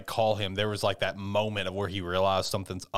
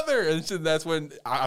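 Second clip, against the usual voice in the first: ordinary delivery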